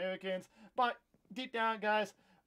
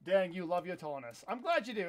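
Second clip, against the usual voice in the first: ordinary delivery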